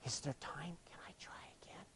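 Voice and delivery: tiny whispered plea; imitating timid asking. Climber coming back to ask for another turn, imitated timid whisper. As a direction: whisper it as a hesitant request. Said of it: "She comes back over like this".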